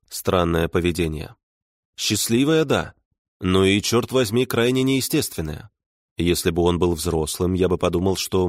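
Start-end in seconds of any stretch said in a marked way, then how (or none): none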